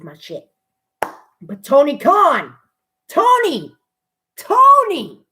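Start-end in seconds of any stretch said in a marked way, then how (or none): 3.1-5.2 excited calling out. Real, not acted. Calling out to Tony Khan.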